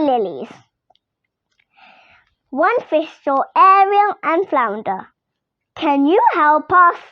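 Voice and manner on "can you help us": plain reading voice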